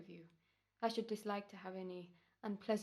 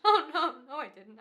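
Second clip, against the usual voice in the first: frantic denial; 5 semitones higher, 13 dB louder than usual. Acted. Killer denies in panic.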